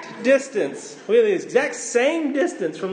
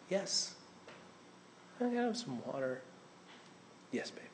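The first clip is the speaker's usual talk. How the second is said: put-on much softer drowsy yes, baby; groggy night-time reply; tired parent softly answering; sleepy murmur; 18 dB quieter than usual. Sleepy dad and child exchange.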